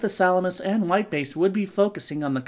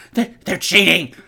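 stammering, louder complaint; loud whiny stammer; 6 dB louder than usual. Acted. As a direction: stumble over it in a panic. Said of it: "he's all".

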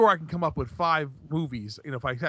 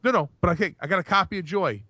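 ordinary delivery

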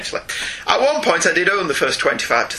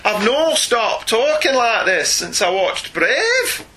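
higher-pitched, hammed-up Scottish brogue; funny Scots accent; 3 semitones higher, slower than usual. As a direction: put on a comic Scots accent. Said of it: "even in a funny accent".

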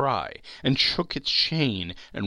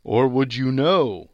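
high, loud, hollow spectre; solemn ghost; 3 semitones higher, 8 dB louder than usual. Acted. Marley's ghost lecturing Scrooge, high and loud. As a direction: pronounce it like a doom.

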